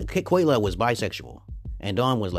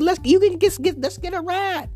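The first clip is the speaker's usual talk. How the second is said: much higher, slow eager offer; mock eager invitation; 4 dB louder than usual. Inviting the girl along, high mock eagerness. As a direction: sing it out up high. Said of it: "I said".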